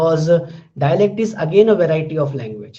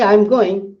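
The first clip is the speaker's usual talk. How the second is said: I'm going said as a plain statement, voice falling on going; just inform them: I'm going, falling at the end; 3 dB louder than usual. Shown as a demonstration. Teacher showing students a declarative, falling tune. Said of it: "you consider it as an information".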